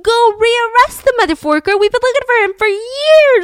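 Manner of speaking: loud, high-pitched incredulous fury; outraged yelling. Acted. Embassy blowing up at Paris police, outraged yelling. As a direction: blow up at them, high and loud. Embassy erupts, all hell breaks loose.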